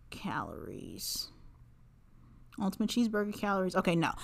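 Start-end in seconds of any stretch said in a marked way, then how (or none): 0.1-1.3 soft whisper while typing. Real, not acted. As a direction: whisper it to yourself.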